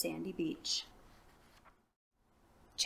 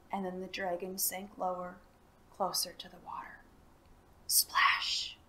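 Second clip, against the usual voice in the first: hushed; 5 dB quieter than usual. A narrator's reading. Dragon sinks to water.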